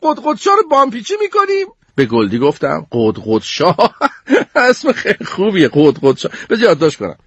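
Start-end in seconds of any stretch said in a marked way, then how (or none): 0.0-1.7 put-on very high, exasperated girl voice; annoyed. Goldie complains angrily about the group's project, very high. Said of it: "با حرص گفت". 2.9-6.3 chuckling through the words; laughing. Acted. Sneeze laughs at Goldie's word for the chicken.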